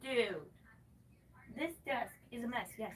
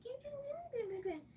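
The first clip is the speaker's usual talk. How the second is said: high squeaky mock voice; mock-whiny imitation; 4 dB quieter than usual. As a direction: parrot them in a silly high voice. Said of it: "for being like".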